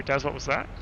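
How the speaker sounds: ordinary talk, nothing marked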